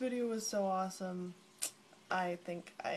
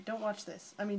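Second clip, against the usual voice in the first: normal talking voice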